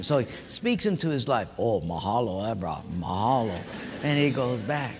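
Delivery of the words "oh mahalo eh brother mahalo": low, softening Hawaiian pidgin; put-on Hawaiian accent, dropping quieter; Hawaiian guy. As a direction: plead low like a laid-back Hawaiian brother. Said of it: "the Hawaiian guy say".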